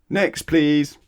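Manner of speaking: high, loud brusque call; loud dismissal. Acted. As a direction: wave the next customer on loudly. Milkman dismissing.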